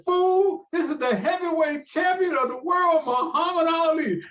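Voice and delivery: high, loud mock champion boast; imitating Ali boasting. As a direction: announce yourself like the brash champ. Retold Ali boasting on phone.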